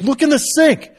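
high, loud acted order; exasperated mom. Wife orders daughter to check the sink, exasperated yell. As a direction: imitate a mother losing patience.

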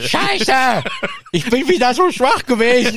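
high, loud comic wail; hammed-up cursing Voldemort. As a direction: curse in frustration like a weakling villain. Voldemort flucht gespielt.

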